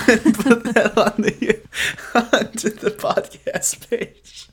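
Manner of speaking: words broken by laughter; laughing while talking. Real, not acted. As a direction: talk through giggles. Laughing through own joke.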